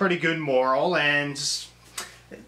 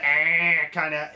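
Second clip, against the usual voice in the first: attempted raspy cartoon sailor noise; badly faked old-cartoon character sound; Popeye; 2 semitones lower, slower than usual. Reviewer groping for the cartoon Popeye voice, half-hearted imitation. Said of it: "I can't really get the voice".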